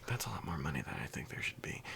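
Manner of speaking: hushed unvoiced thought; whispered aside. Cashier thinking to himself at the register, in a whisper. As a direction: whisper it to yourself.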